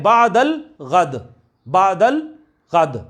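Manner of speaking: बादल गद spaced out slowly, word by word; slow dictation. Shown as a demonstration. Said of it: "परसों को क्या कहते हैं?".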